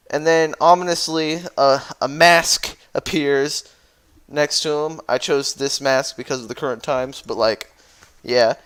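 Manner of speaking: normal talking voice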